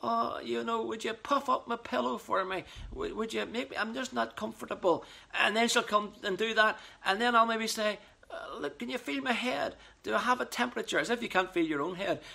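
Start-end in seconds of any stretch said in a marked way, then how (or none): 0.0-5.0 feeble, whimpering sick voice; weak pathetic voice. Retold or imitated. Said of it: "most pathetic voice". 8.3-11.0 weak pathetic voice. Retold or imitated. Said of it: "most pathetic voice".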